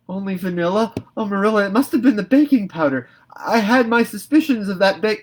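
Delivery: high, loud and flustered; panicked girl. A narrator's reading. Anne flustered before Marilla, high and loud. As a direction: rush out the excuse in alarm.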